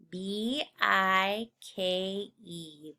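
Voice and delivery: very slow "B I K E", letter by letter; slow spelling. Shown as a demonstration. Teacher spelling the corrected word slowly for the class. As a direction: draw out each letter of "B I K E". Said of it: "so it must be a magic E word".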